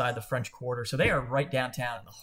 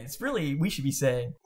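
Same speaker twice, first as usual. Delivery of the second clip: normal talking voice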